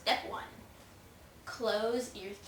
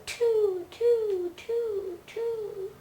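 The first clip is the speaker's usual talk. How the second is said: high sing-song; 11 semitones higher, 5 dB louder, much slower than usual. Pretend. Silly counting of step two.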